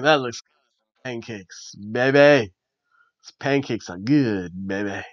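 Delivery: low, soft put-on rumble, slowly savoured; Big E. Big E rubbing his belly over pancakes, low savouring voice. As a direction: imitate a big wrestler relishing food, low and quiet.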